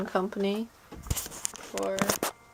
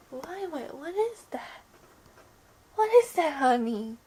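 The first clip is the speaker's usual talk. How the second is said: pet talk; 6 semitones higher, 3 dB louder than usual. Real, not acted. Coaxing scared pet.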